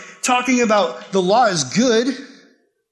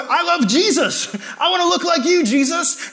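eager devotion; 10 semitones higher, 3 dB louder than usual. Acted. Devoted teen telling Jesus he loves him, high and laughing. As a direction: gush with a laugh in it. Eager believer voiced by pastor.

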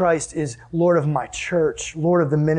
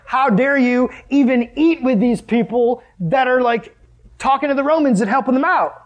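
indignant; 8 semitones higher, 4 dB louder than usual. Acted. Pharisees confront Jesus indignantly.

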